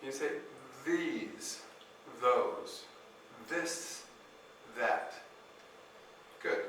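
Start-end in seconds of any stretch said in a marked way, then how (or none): 0.9-5.1 "These, those, this, that" with tongue out under the teeth, soft and drawn out; exaggerated th practice. Shown as a demonstration. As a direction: stretch the TH with the tongue between the teeth. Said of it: "Now to make the T H sound".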